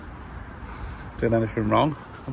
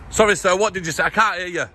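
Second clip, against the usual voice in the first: raised voice, calling across distance; shouting over machinery; 7 semitones higher than usual. Real, not acted. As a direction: shout so he can hear you. Shouted exchange across camp fence.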